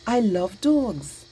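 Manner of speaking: slow, emphatic enthusiasm; drawn-out exclamation of delight; excited. Shown as a demonstration. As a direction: lean on the words with excited feeling. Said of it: "because I'm excited".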